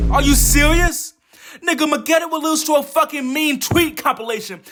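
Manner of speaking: normal talking voice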